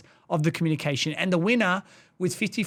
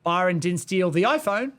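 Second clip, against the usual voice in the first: "iPhone" emphasized at the end, left hanging; stress on iPhone. Shown as a demonstration. Showing one word changes meaning, with stress on "iPhone". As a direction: land the weight on "iPhone", implying another device. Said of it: "we changed the tone of one word".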